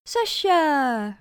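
loud, high, falling greeting shout; cheerful call. A narrator's reading. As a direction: call out gleefully to a sister. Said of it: "roep ik vrolijk".

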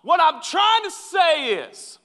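high, loud, slow whine, playing himself mid-argument; whiny dramatic spouse. Mock husband in a fight with his wife, high and whiny. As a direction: stretch it out like a melodramatic husband. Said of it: "I'll be".

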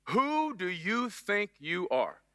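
slow, drawn-out scolding mom; slow accusing mom question. Retold or imitated. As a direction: stretch every word like an angry mother. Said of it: "it's gotta be said in that slow".

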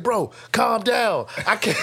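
high, slow mock plea; friend pleading. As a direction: beg him to slow down. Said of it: "He's like".

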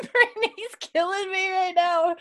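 high-pitched, helpless amusement; laughing. Real, not acted. Host cracking up at co-host's reaction, laughing. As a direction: squeal it through real laughter.

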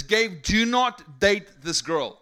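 booming divine warning, slow and loud; voice of the Lord. Retold or imitated. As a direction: deliver it like a divine command from above. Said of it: "the Lord speaks to me and says".

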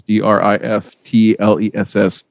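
"D R I F T L E S S" spelled out slowly, one letter at a time. Shown as a demonstration. Spelling the name for listeners at home, slow, letter by letter. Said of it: "it's Driftless, just like it sounds".

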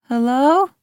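high, rising nervous hello; nervous call. A narrator's reading. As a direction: call out uncertainly into the gloom. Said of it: "Ayla called out nervously".

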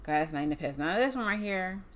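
ordinary talk

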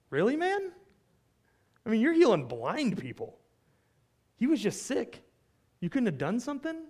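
high-pitched incredulous challenge; skeptical. Acted. Preacher as doubting bystanders challenging Jesus, incredulous. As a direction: question him in exasperated disbelief, voice jumping up. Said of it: "they're suggesting that maybe his tears are a little disingenuous".

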